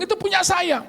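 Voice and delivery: high mock indignant claim. Woman at the pharmacy claiming the parking spot, high indignant.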